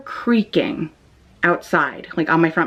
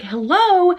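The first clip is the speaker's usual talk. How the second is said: high, wary hello toward the door; nervous call out; 9 semitones higher than usual. Retold or imitated. Alone at night, calling to an unknown knocker, high and wary. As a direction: call out into the dark, scared.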